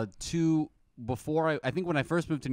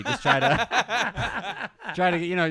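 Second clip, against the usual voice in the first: laughing; 5 semitones higher, 4 dB louder, much slower than usual. Real, not acted. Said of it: "laughing at own upsell".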